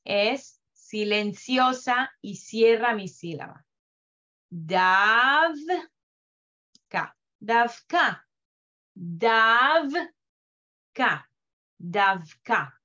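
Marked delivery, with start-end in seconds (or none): 4.5-12.7 "davka" with the v closing the syllable, no vowel after it; silent shva. Shown as a demonstration. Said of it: "Silenciosa quiere decir que no voy a pronunciar".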